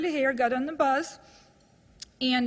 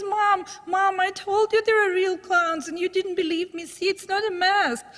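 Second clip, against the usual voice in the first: put-on high, urgent child's voice, pitched well up; a frightened kid proving his mom wrong; scared little boy; 6 semitones higher than usual. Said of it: "the little boy gets even more scared".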